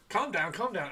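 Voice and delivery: high, loud Scouse "calm down"; mock-soothing comic Scouser catchphrase; Harry Enfield Scouser. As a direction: put on an excitable Scouse accent. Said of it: "Harry Enfield Scouser ref".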